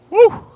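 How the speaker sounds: loud high whoop of delight; excited whoop. Real, not acted. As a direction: let out a big joyful cry. Excited whoop over the cigar.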